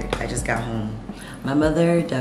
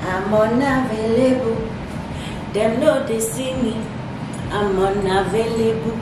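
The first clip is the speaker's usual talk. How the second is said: playful diva; 8 semitones higher than usual. Pretend.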